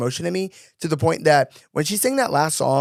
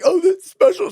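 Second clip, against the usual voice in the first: squeaky sarcastic quip pitched way up; mock sarcastic squeal; 3 dB louder than usual. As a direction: squeal it high, ironically unimpressed. Mocking mom's phone video.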